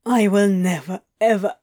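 mildly strained, emotional vow; voice breaking on last word. Acted. Crowley admitting it to Adam, voice breaking. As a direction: let the last word give way. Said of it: "his voice breaking on the last word".

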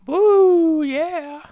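high, loud whoop of delight; excited cheer. Real, not acted. Cheering a player back from the bench, high excited whoop. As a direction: whoop it up like the fans. Cheering for his guy Duffy.

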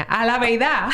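a la verdad with a Cibao Dominican lilt, verdad as 'beiða'; Cibao accent. Shown as a demonstration. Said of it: "así bien caribeña". (acento cibaeño)

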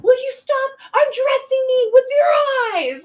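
high, loud mock accusation across the room; shrill accusing yell. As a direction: yell it so the whole restaurant hears. Missy yelling at the couple.

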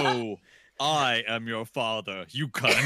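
low, slow lightly voiced villain; mock Vader. Mocking the villain's famous reveal, low and slow.

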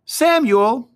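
put-on high boy's call, falling from the top. A narrator's reading. David calls the older shepherd beside him, high boyish call.

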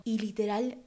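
ordinary talk, nothing marked

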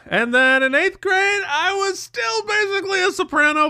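very high, comic falsetto climb; mock soprano. Choir guy joking he stayed soprano through eighth grade, squeakily high. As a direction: send it way up into soprano range.

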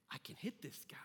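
soft, whispery realization; hushed self-belief. Retold or imitated. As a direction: tell yourself under your breath, daring to believe it. Acted dawning confidence in batter's mind.

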